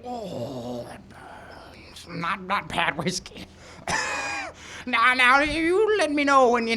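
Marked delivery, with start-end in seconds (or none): 0.0-2.1 breathless whisper. Acted. Burning throat after strong whiskey. 3.9-4.8 harsh cough. Acted. Choking on whiskey.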